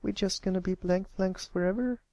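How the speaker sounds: put-on a young filly asking anxiously; young filly Applebloom. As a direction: sound like a worried young pony. Applebloom voiced in story.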